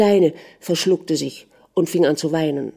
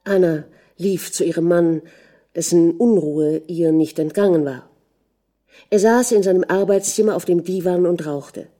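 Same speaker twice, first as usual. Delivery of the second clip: usual speaking voice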